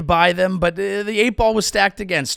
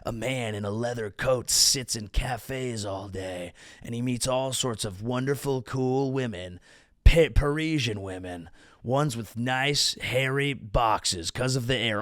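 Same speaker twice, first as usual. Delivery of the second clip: moody Hemingway voice; 5 semitones lower, 6 dB quieter than usual. Retold or imitated. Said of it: "It was more like".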